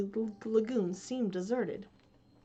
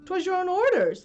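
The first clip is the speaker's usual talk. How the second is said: faltering; 10 semitones higher, 10 dB louder than usual. Acted. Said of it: "the boatswain faltered".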